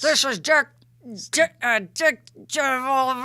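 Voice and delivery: loud, high hype announcer intro; fighting game announcer. Pretend. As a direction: boom it out like a ring announcer. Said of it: "You have to have an announcer voice".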